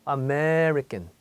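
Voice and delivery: Ame-rican drawn out long on the stressed syllable; stretched stress syllable. Shown as a demonstration. Teacher exaggerates the stress so students hear it. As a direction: hold the stressed part of American extra long. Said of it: "좀 길게 연장이 돼요".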